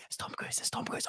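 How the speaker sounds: hushed whisper, as if from the cinema crowd; whispered murmur. Retold or imitated. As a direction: whisper it like an excited moviegoer. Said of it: "you could hear people going".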